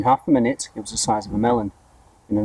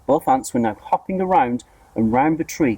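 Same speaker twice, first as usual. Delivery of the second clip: plain reading voice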